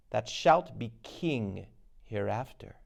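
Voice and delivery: usual speaking voice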